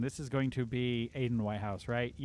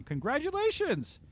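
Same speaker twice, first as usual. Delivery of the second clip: excited congratulations; 13 semitones higher than usual. Real, not acted. Host cheers the prize winner, excited congratulations. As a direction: celebrate the winner with a big pitch lift.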